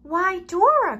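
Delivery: high, loud surprised duck call; quacking duck. A narrator's reading. Duck Debbie marvelling at Dora's chicks, high and loud. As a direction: exclaim like a delighted duck. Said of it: "quacked Debbie".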